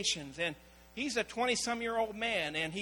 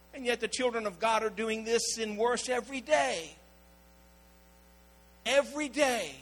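ordinary talk, nothing marked

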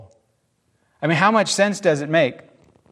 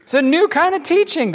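high, thrilled questions; bubbling over with wonder; excitedly; 14 semitones higher, 4 dB louder than usual. A narrator's reading. The synagogue crowd talking excitedly, high-pitched. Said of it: "they asked excitedly".

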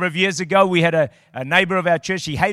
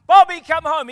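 calling out; 11 semitones higher, 10 dB louder than usual. Retold or imitated. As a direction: cry out into the distance for him.

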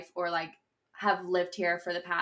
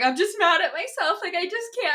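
high-pitched mock meltdown; high, hammed-up frustration; retold exasperation; 10 semitones higher than usual. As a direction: reenact being fed up, pitched way up. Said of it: "I'm just pissed".

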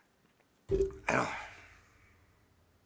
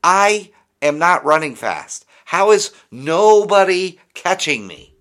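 higher, louder and slow; slow runner's thoughts; 7 semitones higher, 8 dB louder, slower than usual. Retold or imitated. Mocking the slow Wisconsin player's disbelief, loud and slow. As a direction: play the baffled slow runner, drawn out. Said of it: "he's like".

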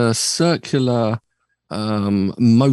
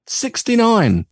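dramatic reveal; 11 semitones higher, slower than usual. Acted.

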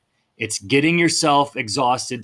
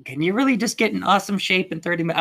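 skeptical mock voice; 6 semitones higher, faster than usual. Mocking skeptical people.

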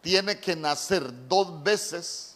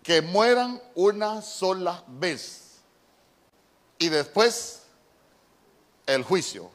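slowed right down, each word weighed; preacher repeating the verse slowly so the church feels it; slow emphatic reading; slower than usual. Real, not acted. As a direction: let the verse sink in, slowly. Second reading, slowed and dwelt on.

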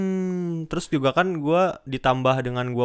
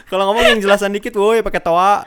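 loud, high heckle through laughter; mock shouted complaint; 8 semitones higher, 8 dB louder than usual. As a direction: yell back jokingly at someone you can't hear. Acted retort to an inaudible coach.

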